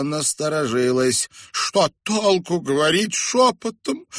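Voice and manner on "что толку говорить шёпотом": high, pitiful complaint; high-pitched whining lament; plaintive. A narrator's reading. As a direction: moan that it is useless. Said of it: "жалобно сказала Хомели".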